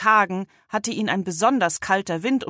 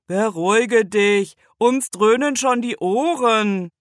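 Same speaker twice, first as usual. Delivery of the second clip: calming uncle; 4 semitones higher, 6 dB louder, slower than usual. A narrator's reading. Uncle calms the donkey.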